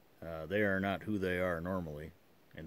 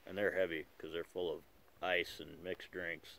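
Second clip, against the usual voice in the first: talking around a pipe. Real, not acted. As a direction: pipe clenched in teeth. Seen 2: Pipe stem clenched in mouth throughout; R frames show no pipe.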